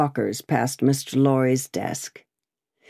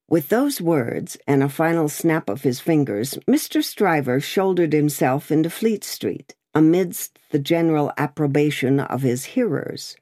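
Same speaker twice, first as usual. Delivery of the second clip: usual speaking voice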